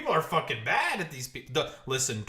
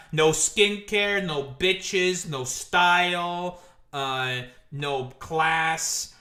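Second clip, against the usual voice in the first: slow roast list; 4 dB louder, much slower than usual. Real, not acted.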